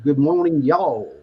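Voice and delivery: lightly put-on American accent. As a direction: try a mild American accent, stretching the y'all. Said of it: "That was my American accent".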